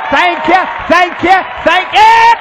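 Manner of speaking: high, loud, rising bursts of thanks; joyful shouting. Retold or imitated. Lady got up dancing, imitated joyful shouting. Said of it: "started shouting and dancing".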